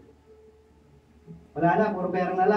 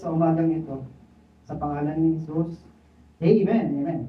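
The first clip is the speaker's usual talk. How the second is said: prayer; 3 semitones lower than usual. Acted. Pastor leading the congregation in opening prayer, earnest. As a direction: pray it low and sincere.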